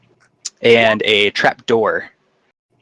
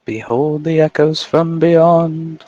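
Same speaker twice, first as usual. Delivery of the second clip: spooky announcer voice; 7 semitones higher, much slower than usual. Pretend. Spooky echo intro voice.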